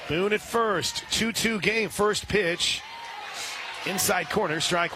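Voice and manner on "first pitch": ordinary talk, nothing marked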